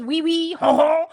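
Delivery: hammed-up French "ho, ho"; mock French. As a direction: play a cartoon Frenchman with a loud French laugh. Mock French after mentioning Paris.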